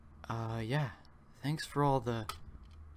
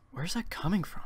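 ordinary talk

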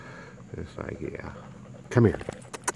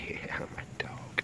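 whisper; 11 dB quieter, slower than usual. Real, not acted. Soothing the dog after eye.

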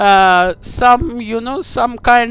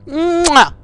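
kiss sound; 4 semitones higher than usual. Acted. Prank caller blowing a kiss.

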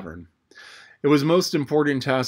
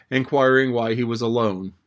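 plain reading voice

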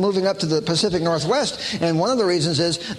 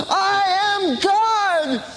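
high, drawn-out mock shout; Shirley MacLaine; 14 semitones higher, much slower than usual. Pupil obeying her teacher on the seashore, mock shout. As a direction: belt it out slowly, hammed-up and high. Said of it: "You've gotta just shout it out".

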